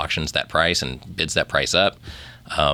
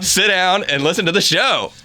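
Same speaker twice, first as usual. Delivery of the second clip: playful bossy; 12 semitones higher, 4 dB louder than usual. Acted. Joking S-word banter.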